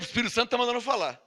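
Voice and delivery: usual speaking voice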